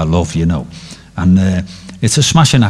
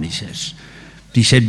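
ordinary talk

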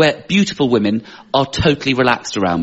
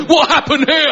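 high-pitched, hammed-up shock; imitating an appalled friend, high and comic; mock astonishment; 11 semitones higher than usual. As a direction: exclaim in exaggerated disbelief, pitched way up. Friends grab his belly, exclaiming.

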